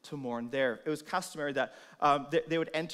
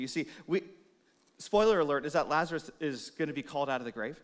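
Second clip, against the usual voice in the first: ordinary delivery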